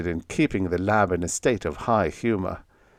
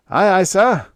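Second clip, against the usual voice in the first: much higher, louder hammed-up sailor's reply; exaggerated salute; 10 semitones higher, 8 dB louder than usual. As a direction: bark it like a cartoon sailor snapping to attention. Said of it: "gave an exaggerated salute".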